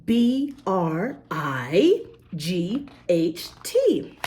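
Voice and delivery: B R I G H T named slowly, letter by letter; spelling out letters. Shown as a demonstration. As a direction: spell it slowly so children can follow. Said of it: "the I in bright".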